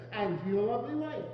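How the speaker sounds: ordinary delivery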